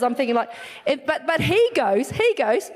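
usual speaking voice

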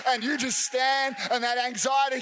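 rising preacher's command; forceful preaching. Acted. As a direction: rebuke the fear with authority.

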